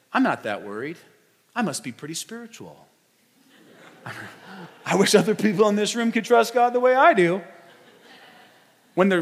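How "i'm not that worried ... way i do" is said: smug self-satisfied. Pretend. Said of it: "you might even sit in this sermon and think".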